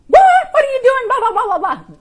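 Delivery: squeaky high, loud, laughing nag; gremlin voice. Acted. Coach voicing the inner gremlin, shrill and laughing. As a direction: play a frantic little gremlin shrieking. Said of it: "our gremlin is going".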